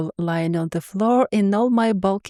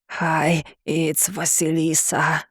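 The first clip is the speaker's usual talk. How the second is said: monster greeting; 4 semitones lower, slower than usual. A narrator's reading. Sea monster greets her crush.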